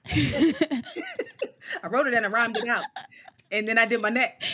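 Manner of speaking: loud laughter through the words; laughing. Real, not acted. Guest laughs remembering her first poem, loud laughter. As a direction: crack up recalling the neck move.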